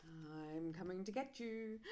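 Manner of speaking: slow, lightly spooky threat; a mild creeping ghostly call. Acted. Skeleton tries to frighten the other, slow and mildly spooky.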